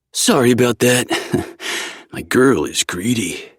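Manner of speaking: playful friend voice. A narrator's reading. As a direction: tease him with a grin. Zev teasing.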